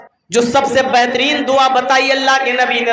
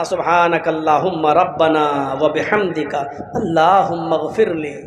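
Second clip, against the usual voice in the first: slow devout recitation; 8 semitones lower than usual. Shown as a demonstration. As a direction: pray it gently and with care. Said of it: "बहुत अच्छे अंदाज में पढ़ना".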